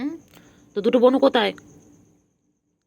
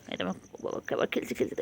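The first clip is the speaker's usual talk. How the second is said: hushed, so as not to disturb; whisper; 11 dB quieter, faster than usual. Real, not acted. Whispering while the kitten plays. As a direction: breathe the words out.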